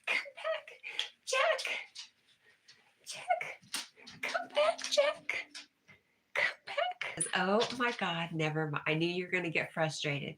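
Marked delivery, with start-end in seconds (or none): 0.1-7.1 tiny falsetto pet call; squeaky calling. Real, not acted. Calling Jack back, very high falsetto.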